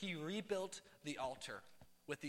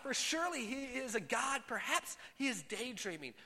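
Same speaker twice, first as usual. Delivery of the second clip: higher-pitched mocking reading; scoffing taunt; 6 semitones higher than usual. A narrator's reading. Elijah scoffing at Baal's prophets, mocking. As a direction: read it as a taunt, pitch lifted. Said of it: "he scoffed".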